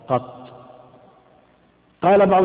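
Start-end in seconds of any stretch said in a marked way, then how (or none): 0.1-0.5 "قط" as short qat, ending on a vowelless ta; "قط" pronounced qat, no final vowel; qaf open, ta silent-vowelled. Shown as a demonstration. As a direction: say "قط" with fatha, then stop on the ta. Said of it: "مفتوحة القاف ساكنة الطاء".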